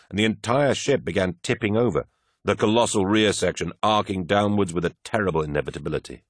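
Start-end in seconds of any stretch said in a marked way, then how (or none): none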